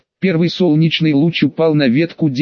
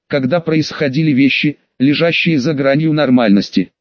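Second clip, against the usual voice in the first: ordinary delivery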